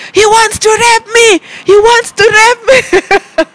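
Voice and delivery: high, slow, crying scream for help; retold screaming. Screaming at the man in the pool, high panicked yell. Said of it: "I started shouting and screaming".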